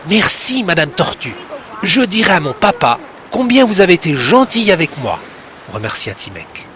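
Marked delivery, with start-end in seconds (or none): 0.1-5.2 put-on grateful boy. A narrator's reading. Boy thanks the turtle.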